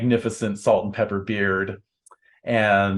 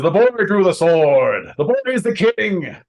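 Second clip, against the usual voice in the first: high, loud declaration to a crowd; royal proclamation; 11 semitones higher, 6 dB louder than usual. Acted. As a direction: proclaim the new king, ringing out. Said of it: "He says".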